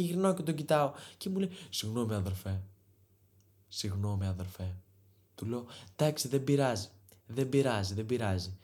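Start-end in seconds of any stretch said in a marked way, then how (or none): 1.7-4.8 much lower, softer bro apology; lightly voiced low mumbling clubber; meek apology. Guy apologising after bumping. 6.0-8.5 understated fake calm forgiveness; mock polite.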